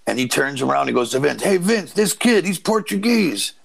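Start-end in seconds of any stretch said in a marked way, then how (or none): 1.4-3.5 lightly voiced excited older man, much higher, slowing at the end; mimicking an excited agent. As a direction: call out to the boss like an eager old agent. Agent calls over to the boss.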